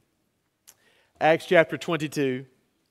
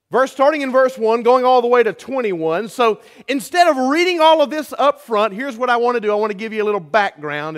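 rising, louder preacher's push; preaching at full force; 6 semitones higher, 6 dB louder than usual. Real, not acted. Pastor to his church, preaching at full force. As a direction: build it up like a pulpit sermon.

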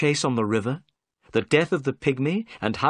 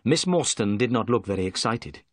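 plain reading voice

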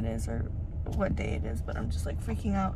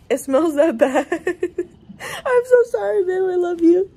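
much higher, loud laughing apology; 8 semitones higher, 10 dB louder than usual. Real, not acted. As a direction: giggle out the apology.

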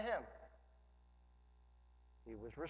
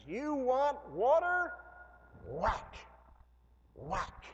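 much higher, much louder, slow furious snap; angry Moses; 10 semitones higher, 8 dB louder, slower than usual. Retold or imitated. Moses fed up with complaining people, furious loud outburst. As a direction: lose your temper at the grumbling crowd. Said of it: "he goes".

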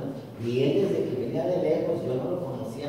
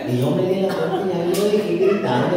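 fast, louder telling through laughter; laughing; 6 semitones higher, 10 dB louder, faster than usual. Real, not acted. Priest retelling the widow turning away, fast laughing telling. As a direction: rattle it off while laughing.

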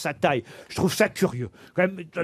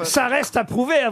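ordinary talk

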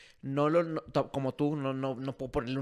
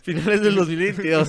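louder and higher, laughing through the corrected year; laughing correction; 5 semitones higher, 10 dB louder than usual. Real, not acted. Host corrects his own date slip, laughing loudly. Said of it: "Perdón".

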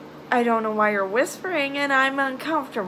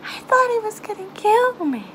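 frightened; 7 semitones higher than usual. Real, not acted. Repeating fear, second take stronger.

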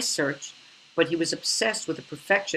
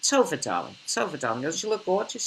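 put-on British accent, Tova almost Sova; mock posh British relative greeting; gushing British accent, lightly voiced; 4 semitones higher than usual. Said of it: "the same thing with the British accent".

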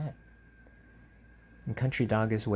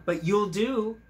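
higher and louder, delighted retelling; bright retold quote; 9 semitones higher, 7 dB louder, slower than usual. Retelling the frog's words to the listener, higher and louder. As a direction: pop it up with delight. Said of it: "He told City Dog".

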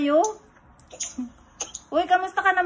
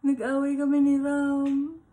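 fake crying. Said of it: "I thought you were crying".

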